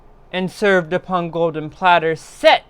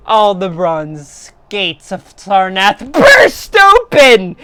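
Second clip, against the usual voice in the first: louder, building storyteller intensity; rising dramatic narration; 5 semitones higher, 7 dB louder than usual. A narrator's reading. Narrator telling listeners of Sarnath's panic, with mounting drama. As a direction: swell the voice as the city breaks into panic. Dramatic climax of doom tale.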